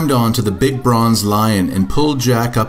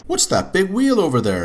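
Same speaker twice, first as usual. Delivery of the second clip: put-on much higher small boy; little boy voice; 7 semitones higher than usual. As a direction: say it like an awed boy. Jack voiced.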